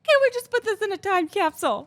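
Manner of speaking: high, loud starstruck gush; re-enacted giddy fan excitement; freaking out. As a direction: gush as if the moment must be saved. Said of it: "Like, I was freaking out".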